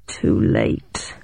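low, hushed murmur. A narrator's reading. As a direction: murmur it under your breath, very low. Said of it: "murmured Rutter".

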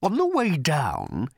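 slow creature snarl. Acted. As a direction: spit it out slowly. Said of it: "he snarled".

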